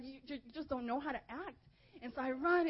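ordinary talk

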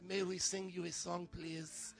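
soft, lightly attempted English accent; a small, polite English-accented request. Pastor imagining Tiny Tim at the door, weak English accent. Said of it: "he'll have, like, an English accent".

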